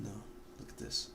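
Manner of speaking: ordinary talk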